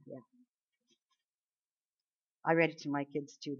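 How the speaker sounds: ordinary talk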